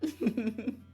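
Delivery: high giggle; short high burst of laughter; laughing. Real, not acted. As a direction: giggle after the bit. Laughs after the squeaky thanks.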